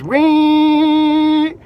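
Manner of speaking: high, squealing mock "Wee!" of spinning tires; imitated tire squeal. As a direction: squeal it like wheels spinning in the air. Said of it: "all you hear is".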